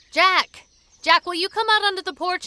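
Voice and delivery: loud call toward the cabin. Acted. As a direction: call his name across the yard. Calling out to the cabin.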